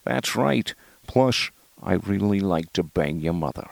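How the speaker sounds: lightly voiced Connery impression, falling lines. Comic telling listeners about a fun voice, casual Connery impression. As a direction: put on a casual, not-great Connery. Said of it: "what's funny about Connery".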